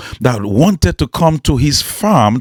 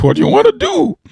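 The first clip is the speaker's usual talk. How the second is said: light questioning father; faster than usual. Acted.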